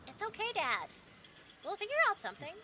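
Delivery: put-on high, bright girl's voice reassuring her dad; cheerful daughter voice. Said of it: "And you go".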